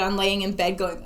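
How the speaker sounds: usual speaking voice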